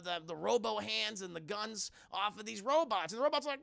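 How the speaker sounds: usual speaking voice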